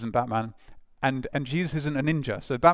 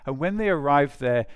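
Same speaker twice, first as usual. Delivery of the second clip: ordinary talk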